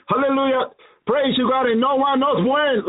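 ordinary delivery

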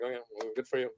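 mock a generic wrestler under your breath; weird put-on voice. Teller brushing off a wrestler's bragging in a quiet put-on voice. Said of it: "I don't know why I made that voice".

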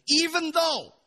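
loud, high burst of emphasis; emphatic preaching shout. Real, not acted. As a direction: punch it out high to the congregation. Preacher stressing a point at peak.

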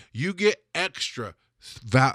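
ordinary talk

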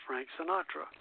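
usual speaking voice

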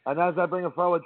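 normal talking voice

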